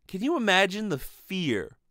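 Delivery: ordinary talk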